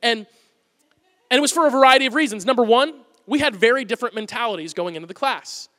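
ordinary delivery

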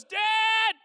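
put-on high, loud child's shout; a loud high child's cry for Dad; toddler yelling Dad. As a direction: scream Dad like a toddler at night. Toddler screaming his name.

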